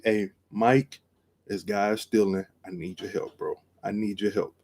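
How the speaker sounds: quiet urgent radio call for backup; hushed call for help. Retold or imitated. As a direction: keep it down so the thief can't hear.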